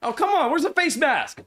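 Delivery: loud, high yell at the ref; outraged protest. Real, not acted. Fan protesting a missed face mask call, high outraged yell. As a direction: cry foul at a missed call.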